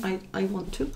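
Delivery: I, I want to, said softly under the breath; soft repeat. Real, not acted. Repeating to herself that she wants to, quietly. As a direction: murmur it again to herself. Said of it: "I don't have to".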